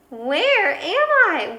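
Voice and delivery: slow, high, loud wondering; wondering aloud. A narrator's reading. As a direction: stretch out each word in puzzlement. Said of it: "wondered Skipito aloud".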